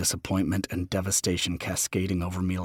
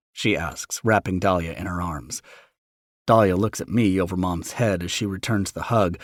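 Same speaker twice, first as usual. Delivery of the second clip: ordinary delivery, nothing marked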